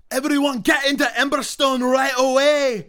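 high, full-force dwarf shout; loud, high rallying cry; Krieg shouting. Acted. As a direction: bellow an order to the whole area. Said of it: "Krieg also shouts to the entire vicinity".

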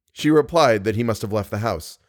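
usual speaking voice

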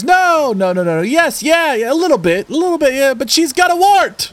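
high, hammed-up crowd voices tumbling over each other; crowd's backpedalling. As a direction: act out a mob denying, admitting, then accusing. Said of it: "And the crowd goes".

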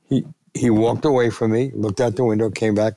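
usual speaking voice

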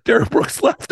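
high, breathy laugh-talk; speaking through real laughter; laughing through words. Real, not acted. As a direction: giggle the words out. Laughing while retelling dad story.

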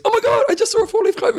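put-on very high squeaky girl voice; excited schoolgirl. Retelling the girl behind him on the walk to school, excited schoolgirl. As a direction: squeal it in a mock schoolgirl falsetto. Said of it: "a girl behind me goes".

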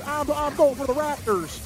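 high, lightly voiced imitation of Dabo; mock the coach's cheer; imitating Dabo. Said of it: "He goes".

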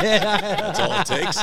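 laughing high and slow, real laughter in the voice. Real, not acted. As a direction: laughs through the line, amused.

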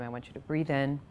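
gentle breathing cue. Shown as a demonstration. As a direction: guide the in-breath softly.

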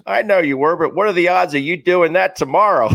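high, punchy comeback; imitated manager retort. Manager doubting a player can repeat it, acted retort.

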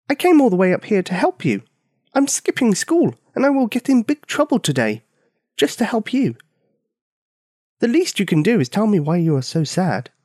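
put-on high, fast girl pleading; pleading little girl. Girl scolding the sad giant, high and quick. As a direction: press him, high and urgent. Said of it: "Bonnie added".

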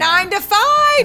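high, loud, drawn-out thrilled cry; excited. Real, not acted. Fan naming the trio's old film, excited. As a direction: belt out the title like a thrilled fan. Gushing over a trio reunion.

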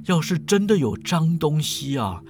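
slow, drawn-out lecturing voice; measured, teasing professor; unruffled old professor. Acted. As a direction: advise at a leisurely pace, stretching the endings.

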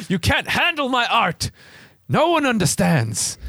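high, hammed-up wounded artist; mock artistic tantrum in a high voice; misunderstood artist. Joking as a misunderstood artist creature.

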